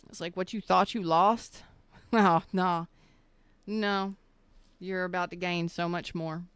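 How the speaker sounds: normal talking voice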